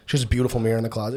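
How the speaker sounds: ordinary delivery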